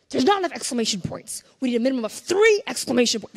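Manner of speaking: hammed-up emphatic tweet boss; bossy excited. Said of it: "That's how I sound when I tweet".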